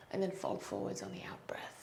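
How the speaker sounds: soft whispered cue on the out breath; a calm yoga teacher's whisper. Real, not acted. Yoga cue given on the exhale.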